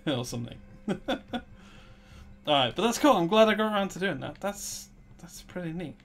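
normal talking voice

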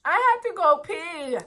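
high, imitated small-child voice; little daughter. Mother imitating her daughter buckled in the car, child voice. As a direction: mimic a little girl's plea, pitched well up. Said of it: "what she say to me".

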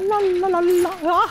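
high, loud string of syllables; panicked babbling wail. Real, not acted. Panicked noises in game.

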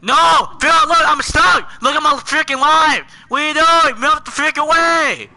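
loud, exasperated complaint; overreacting. Real, not acted. Stuck player pleading with friend, loud exasperation. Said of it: "now he's just overreacting".

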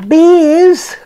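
high, loud disgusted cry; cried out. Acted. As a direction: cry out in horror at beans. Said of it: "cried Donald".

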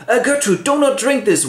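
high, urgent warning; alarmed king. Acted. King warns queen about poison.

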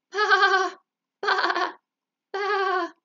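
loud, high bleat; sheep bleating. Acted. Sheep singing to the buck, loud high bleat.

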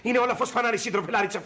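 ordinary talk, nothing marked

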